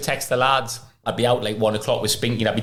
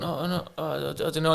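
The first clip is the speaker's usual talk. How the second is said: high, softer, stammering fluster; mock panicking; 7 semitones higher, 5 dB quieter than usual. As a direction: play a physio caving under pressure. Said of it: "just panicking".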